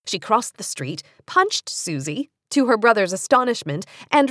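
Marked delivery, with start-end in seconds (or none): none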